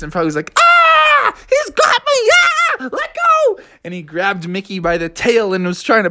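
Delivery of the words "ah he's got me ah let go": very high, hammed-up cry of alarm; panicked yelling. Froggy with Mickey on his face, mock panicked squeal. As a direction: squeal for help like a cartoon victim.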